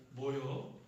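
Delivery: all-purpose Chungcheong one-word reply; Chungcheong dialect. Shown as a demonstration. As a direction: say it like a Chungcheong local. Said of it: "충청도 사람들이 쓰는 단어". (충청도 사투리로)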